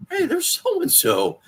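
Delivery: greeting a fellow fan spotted at the show; cheerful call-out. Retold or imitated. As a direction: spot a friend and call out gladly. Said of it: "And I'm like".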